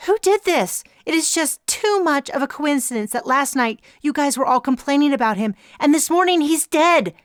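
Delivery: high, loud, near-hysterical accusation. A narrator's reading. Glasses accusing the others, hysterical and high. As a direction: fling the accusation, panicking. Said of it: "her hysterical tone carried to me".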